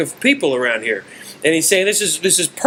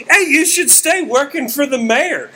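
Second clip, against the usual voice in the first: exasperated heckle pitched way up; Bill Burr; 12 semitones higher than usual. Acted. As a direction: imitate a worked-up stand-up giving blunt advice. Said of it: "Bill looks at me and goes".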